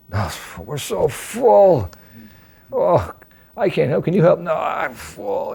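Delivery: drawn-out, high, lazy no; slow, full-up refusal. Retold or imitated. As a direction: refuse slowly, as if stuffed with food. Devotees refuse to help carry.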